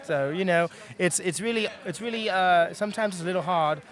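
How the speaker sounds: steady British accent held on request. Shown as a demonstration. Voice actor shows the interviewer his British accent. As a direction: keep the British accent going, easy and casual. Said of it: "So now I can switch back to the New York one".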